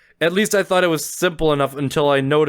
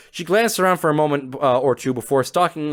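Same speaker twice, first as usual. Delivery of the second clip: normal talking voice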